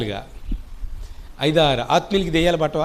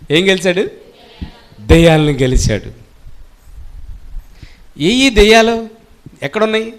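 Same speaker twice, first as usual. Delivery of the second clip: loud, ringing sermon proclamation; full-force preaching; 5 dB louder than usual. Acted. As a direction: preach it out to the congregation. Preacher proclaims victory, congregation responds.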